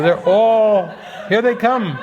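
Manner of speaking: ordinary talk, nothing marked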